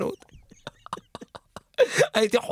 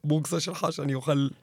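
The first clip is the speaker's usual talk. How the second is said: solemn confession; 7 semitones lower than usual. Acted. Caller confessing his sin to the radio host, low and solemn.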